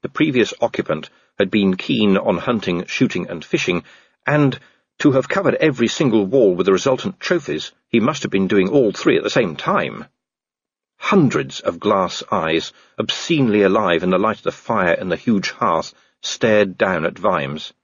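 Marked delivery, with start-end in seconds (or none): none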